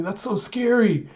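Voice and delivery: high, thin-volume worried thought; acted fright. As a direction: act scared without raising the volume. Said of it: "you see Yor thinking".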